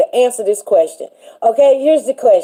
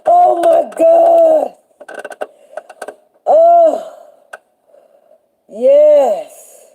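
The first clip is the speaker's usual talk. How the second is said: real amazement at her own ideas; drawn-out amazed cry; 5 semitones higher, much slower than usual. Real, not acted. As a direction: let it ring out in wonder. Overwhelmed exclamation.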